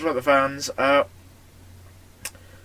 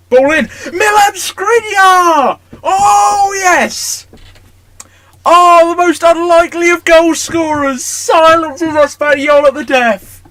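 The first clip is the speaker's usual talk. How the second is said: screaming, much louder and higher commentator-style celebration; ecstatic goal shout; 12 semitones higher, 12 dB louder, slower than usual. Real, not acted. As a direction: roar it like a late winner just went in. Last-minute goal celebration.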